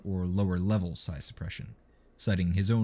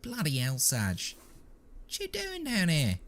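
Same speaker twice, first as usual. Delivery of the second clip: high, whiny trooper voice; plaintive whine; 9 semitones higher, 4 dB quieter than usual. A narrator's reading. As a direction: whine it, caught out. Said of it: "a plaintive".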